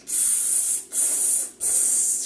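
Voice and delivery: sighing tsk. A narrator's reading. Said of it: "soupire le serpent".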